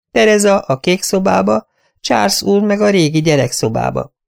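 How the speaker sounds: high, anxious, eager-to-please; nervous little voice, pitched well up; timid companion voice. Acted. Companion listing room plans to her mistress, high and anxious.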